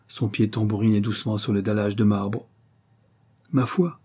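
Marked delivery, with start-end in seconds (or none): 3.5-4.0 higher-pitched show of casualness; lightly affected offhandedness; feigned nonchalance. A narrator's reading. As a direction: toss it off as if unconcerned. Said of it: "avec une fausse désinvolture".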